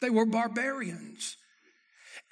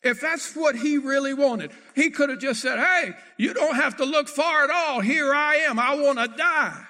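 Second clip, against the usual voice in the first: loud, high preacher's cry; full-force preaching shout; 5 semitones higher, 7 dB louder than usual. Acted. Preacher crying out Elijah's would-be surrender, loud. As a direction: shout it to the back pews.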